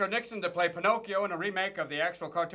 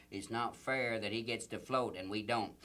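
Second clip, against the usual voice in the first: much lower, softer slow whine; Klansman voice; 8 semitones lower, 8 dB quieter than usual. Acted. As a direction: grumble it low and slow. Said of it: "Ku Klux Klan sues".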